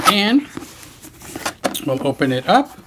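ordinary delivery

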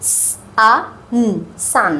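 সান split into স, আ, ন then blended; sounding out. Shown as a demonstration. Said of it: "এর উচ্চারণ".

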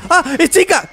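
high, loud startled shout; sudden high shout of alarm; startled cry. Real, not acted. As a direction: jolt of real surprise at the monster. Chica suddenly appears.

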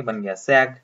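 ordinary talk

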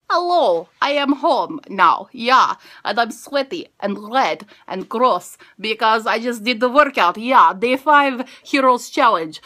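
put-on loud, slow playful Russian accent; mock Russian accent. Back home sweaty from a workout, in a playful Russian accent. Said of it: "Why are we in a Russian accent today?".

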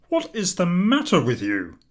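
husband alarmed as his wife cries out; husband demanding. A narrator's reading. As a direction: demand to know what's wrong. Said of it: "demanded her husband".

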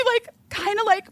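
usual speaking voice

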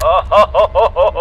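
loud hearty laugh. Real, not acted. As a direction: laugh at his fright. Laughing at scared player.

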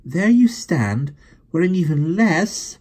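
high, slow, loud indignation; indignant. Acted. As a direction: rebuke him sharply. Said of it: "she began indignantly".

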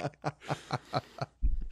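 high, breath-heavy laughing fit; helpless real laugh; real laughter. Real, not acted. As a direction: crack up at your own joke. Laughing at own joke.

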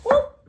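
high loud whoop; playful whoop. Pretend. Cutting the tomato for viewers: playful high whoop.

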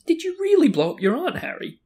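high, loud, insistent question; serious, high-pitched girl's questioning. A narrator's reading. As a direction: press him in earnest, pitched high. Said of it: "in a very serious voice".

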